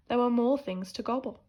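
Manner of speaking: plain reading voice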